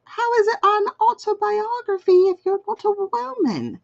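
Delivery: high mock-outraged child voice; high indignant kid voice; child's indignant voice. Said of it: "because I was like".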